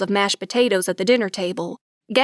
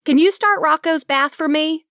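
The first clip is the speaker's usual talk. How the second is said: called out loud and high from another room; yelling to brother; 6 semitones higher, 4 dB louder than usual. Acted. Sister yelling to her brother down the hall, loud call. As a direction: shout it down the hall to your brother. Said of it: "I yelled to my brother".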